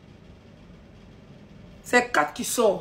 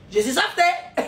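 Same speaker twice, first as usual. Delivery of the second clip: higher-pitched mock advert slogan; mimicking an advert; 5 semitones higher, slower than usual. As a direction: parody a TV commercial catchphrase. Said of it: "Qui se rappelle des publicités là?".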